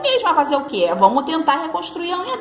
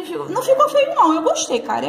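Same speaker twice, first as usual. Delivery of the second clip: much higher, delighted squeal of approval; surprised by how good the nail turned out; pleasantly surprised; 5 semitones higher than usual. Real, not acted. Pleased with her own result. (surpresa contente, bem aguda)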